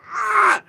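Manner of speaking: very high, loud "Ah!" yelp; imitated dog's startled yelp. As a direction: copy his sudden yelp. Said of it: "And he's like".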